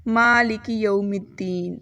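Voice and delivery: slow مالک یوم الدین; recitation. Shown as a demonstration. Teacher reading the next verse. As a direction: recite slowly, lengthening ما. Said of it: "ہمارے پاس آتا ہے".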